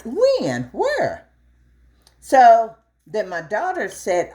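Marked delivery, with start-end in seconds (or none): 0.0-1.3 surprised. Retold or imitated. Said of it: "And I'm like".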